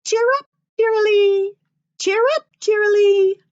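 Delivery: high, loud chirpy birdcall imitation; imitating a robin's cheery call; robin's song. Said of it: "the song that a robin sings".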